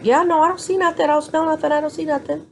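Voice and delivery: high mock nurse voice, brushing her off; dismissive nurse. Mocking the nurse who won't check, high and dismissive. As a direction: play the nurse shrugging off the complaint.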